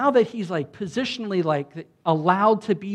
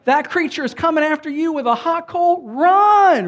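high, loud playful shout of alarm; mock panicked yell; 11 semitones higher, 12 dB louder than usual. Preacher pretending to warn Isaiah of the coal, comic loud yell. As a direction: yell a warning to Isaiah, half joking. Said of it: "but I'd be like".

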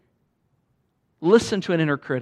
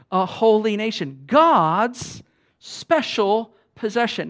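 louder, higher, very slow proclamation; sparring declaration; 5 semitones higher, 5 dB louder, slower than usual. Shown as a demonstration. Pastor declaring scripture against the inner critic, loud and slow. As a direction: land each word like a blow. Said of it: "like you're sparring".

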